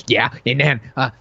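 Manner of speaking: mock an over-keen film pitch; gabbled pitch, voice climbing; hyped-up pitch meeting.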